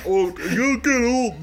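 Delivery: slow, exaggerated Canadian accent; cartoon Canadian accent, vowels stretched; mock Canadian. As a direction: put on a thick Canadian O, drawn out. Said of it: "not that Canadian, like".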